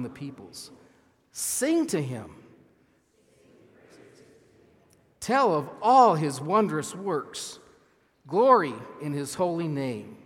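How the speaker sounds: slow, deliberate reading with key words punched; emphatic scripture reading. Shown as a demonstration. Pastor leads congregation reading a psalm together, with emphasis. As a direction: lead a group reading, leaning on key words. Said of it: "put some emphasis as you read it".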